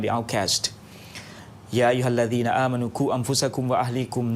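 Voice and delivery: measured, solemn opening recitation; formal invocation. Acted. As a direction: recite the blessing steadily. Opens radio program with Islamic invocation.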